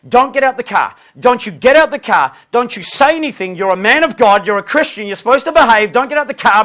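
nagging wife. Retold or imitated. Wife warning husband not to confront driver, imitated nagging. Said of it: "she began to say".

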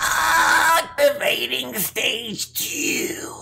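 put-on old robot voice. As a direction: creak it out like an ancient machine. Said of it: "Is he ninety now?".

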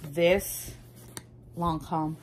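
ordinary talk, nothing marked

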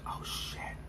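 a whispered curse of dawning fear; hushed curse; shocked whisper. Retold or imitated. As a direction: breathe it out, voiceless and stunned. Realizes window left open.

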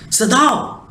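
narrated cry, pitch rising sharply. A narrator's reading. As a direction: call out the name sharply, pitched high. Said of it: "Hana cried sharply".

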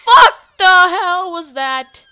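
loud, high burst of shock; real startled shout. Real, not acted. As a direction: yell it in shock, drawn out, easing off. Jump scare just happened.

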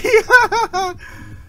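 a squeaky high laugh breaks out; high burst of laughter. Real, not acted. Gamer laughing at his own frustration, high laugh. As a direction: laugh at himself, high and sudden.

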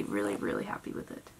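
breathy whisper of delight. Real, not acted. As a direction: whisper it like a secret. Whispered aside to camera.

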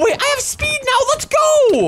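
high, thrilled burst; elated battle cheer; excited. Acted. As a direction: cry out as the power-up kicks in. Gains speed in the fight.

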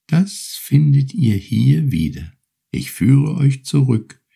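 high, gentle turtle voice, unhurried; grateful little turtle. Acted. Turtle thanks Urashima for freeing her, high and gentle. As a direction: offer your thanks like a small polite sea creature.

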